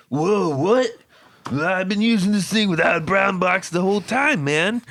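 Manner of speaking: high, loud cartoon bus driver; goofy high stoner drawl, cartoon dude; Simpsons bus driver voice. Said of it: "Did you really sound like the drunk guy from Simpsons".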